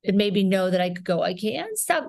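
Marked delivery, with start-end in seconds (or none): 1.2-2.1 high but softened Queens accent; relaxed Queens accent. Shown as a demonstration. Actor showing the accent rhythm she learned, relaxed Queens accent. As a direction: ease into the Queens rhythm on "can't stop". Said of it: "I realized I could relax".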